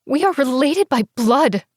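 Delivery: high, loud desperate protest; crying out. Acted. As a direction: cry out the plea to make him stop. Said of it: "I cried".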